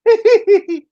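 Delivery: very high, loud squeaky titter; struggling hee-hee laughter. Shown as a demonstration. Host struggling with the exercise, hee-hee laughter. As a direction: force out a very high "hee-hee" giggle. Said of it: "Struggling with the hee hee".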